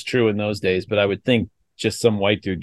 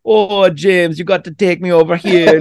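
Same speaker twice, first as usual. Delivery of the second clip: higher-pitched mock Jamaican accent; imitated Jamaican accent; 7 semitones higher, 4 dB louder than usual. Podcaster mimicking an audiobook narrator's Honey Child, Jamaican accent. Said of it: "gives Honey Child Ryder a Jamaican accent".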